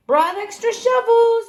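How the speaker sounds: high, loud call. A narrator's reading. Luke arrives to help clean the library, calling out. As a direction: sing out the news across a distance. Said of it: "Luke called".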